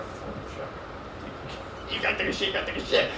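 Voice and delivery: hushed whisper of panic. Retold or imitated. To himself in the bathroom, whispered panic. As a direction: breathe it under your breath.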